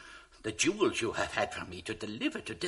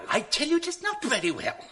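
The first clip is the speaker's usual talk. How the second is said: higher, pressing; angry insistence; 9 semitones higher, 4 dB louder than usual. Acted. Dupe insists to schemer, angry. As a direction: insist hotly.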